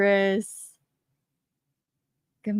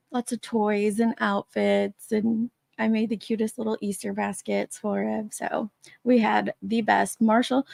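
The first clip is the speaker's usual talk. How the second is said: normal talking voice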